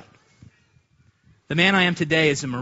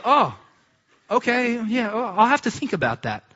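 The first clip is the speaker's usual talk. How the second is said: mock casual; 6 semitones higher than usual. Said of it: "But I kept it cool".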